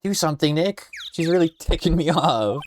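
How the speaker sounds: high-pitched, irritated plea; peeved, pushing someone to act; annoyed game character. Acted.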